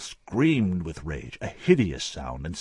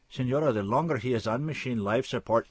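higher, pleading doctor; anxious surgeon voice; 7 semitones higher than usual. Acted. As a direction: urge her before she cuts in.

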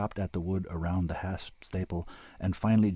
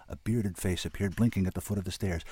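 usual speaking voice

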